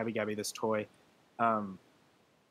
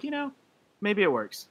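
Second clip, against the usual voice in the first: hopeful quote; 8 semitones higher than usual. Retold or imitated. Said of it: "I was like".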